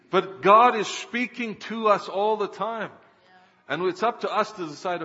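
high, loud preacher's insistence; impassioned preaching. Real, not acted. Preacher to congregation on God speaking, impassioned preaching. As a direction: drive it home from the pulpit.

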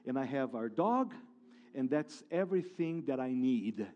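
a man at peace with losing his house, speaking softly; calm, soft reply. Retold or imitated. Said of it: "the guy very calmly saying".

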